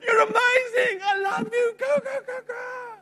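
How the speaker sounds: high, loud, slow cheer of a proud father; a father cheering his daughter at her race, high shout; cheering dad. Retold or imitated. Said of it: "Next to her, say".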